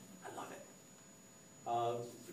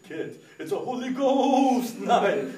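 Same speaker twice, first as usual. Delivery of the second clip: slow, high, stretched-out showman's call; drawn-out, raised-pitch hype; hyped announcement; 7 semitones higher, 6 dB louder, much slower than usual. Retold or imitated. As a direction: announce it grandly to a crowd of kids. Announcing the event to kids.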